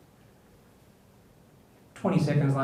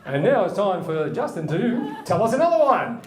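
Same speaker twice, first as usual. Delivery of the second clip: loud, slow, high announcer call; playful game-show introduction; 4 semitones higher, 5 dB louder than usual. Pretend. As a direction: call up the next act with a big drawn-out flourish. Host calls up next joke teller.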